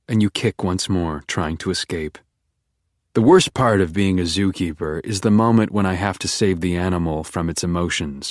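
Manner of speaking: ordinary delivery, nothing marked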